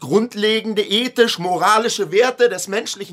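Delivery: hoch und laut, getragen deklamiert; Schreien. Acted. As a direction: predige es wie eine Paragraphenrede, immer höher. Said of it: "Du schreist in meiner Wohnung". (laut deklamierend, höher steigend)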